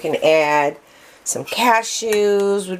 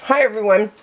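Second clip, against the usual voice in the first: normal talking voice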